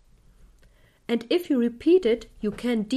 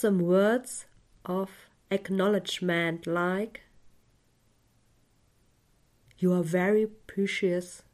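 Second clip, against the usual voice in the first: guided meditation. Acted. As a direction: guide the listener gently into calm.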